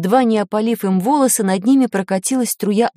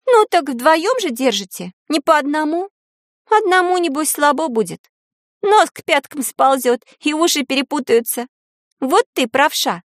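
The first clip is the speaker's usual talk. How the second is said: put-on higher-pitched girl's voice, casually needling; feigned indifference; 6 semitones higher than usual. A narrator's reading. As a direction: taunt them lightly in a raised voice. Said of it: "сделанным равнодушием".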